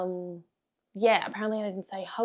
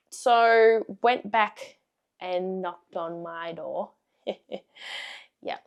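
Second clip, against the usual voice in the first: normal talking voice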